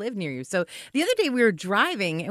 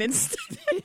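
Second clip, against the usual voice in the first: high squeaky voice; 6 dB quieter than usual. Retold or imitated. Neighbor correcting, high pitch.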